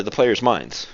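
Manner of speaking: ordinary delivery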